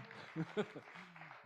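high, bursting laugh of delight; joyful laugh. Real, not acted. Preacher laughing in delight at the homecoming. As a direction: laugh out in joy. Joyful outburst mid-sermon.

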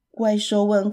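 usual speaking voice

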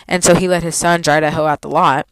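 ordinary delivery

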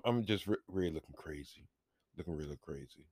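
low, hushed embarrassment; quiet. Real, not acted. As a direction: murmur it under breath. Embarrassed trailing remark.

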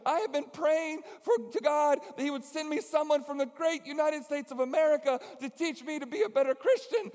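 put-on high, loud, hopeful young woman; high eager girl. Amy asking the preacher if he is the leader, high and eager.

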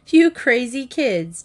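giggling. A narrator's reading. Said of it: "Janet giggled".

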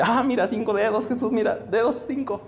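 lightly acted excitement, showing off a wonder; mildly thrilled. Juan showing Jesus five fingers, mildly excited. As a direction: marvel with a touch of excitement. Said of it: "Emocionado con, con esto".

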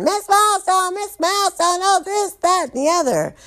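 high squealed clamor for attention; women screaming. Acted. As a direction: squeal like a crowd of excited inmates. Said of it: "women screaming".

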